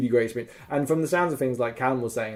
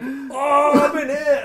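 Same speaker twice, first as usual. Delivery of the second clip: excited yelp; 13 semitones higher, 5 dB louder, slower than usual. Retold or imitated. Hit by a shot.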